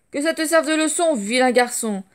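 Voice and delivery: loud, high stern scolding; loud furious mother's rebuke; angry scolding. Acted. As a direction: punish the naughty boy with your voice. Mother elephant scolding brat.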